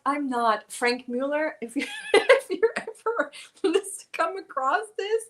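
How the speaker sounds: ordinary talk, nothing marked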